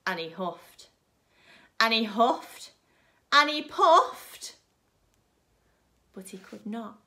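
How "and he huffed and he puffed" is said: loud, slow, higher huffing; storyteller acts the wolf straining hard. Acted. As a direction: build up the effort of huffing.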